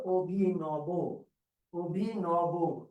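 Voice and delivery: অভিনব drawn out as "o-bhi-no-bo"; demonstrated অভিনব. Shown as a demonstration. Teacher giving the answer to question eighty-two, slow syllabic pronunciation of অভিনব. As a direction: say অভিনব slowly, syllable by syllable, rounded ও vowels. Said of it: "অভিনব শব্দের উচ্চারণ হলো".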